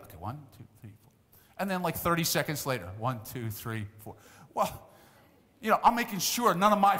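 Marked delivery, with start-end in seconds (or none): 0.0-1.1 hushed counting; quietly counting heads; counting under breath. Retold or imitated. Counting kids at the shore. 3.0-4.1 softer hushed counting; counting under breath. Retold or imitated. Counting kids again.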